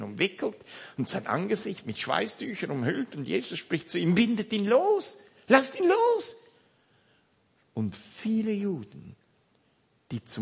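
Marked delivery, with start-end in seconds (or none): 4.1-6.4 higher, urgent command to set him free; commanding call. A narrator's reading. Jesus telling the crowd to unbind Lazarus, urgent call. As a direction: cry out to release him.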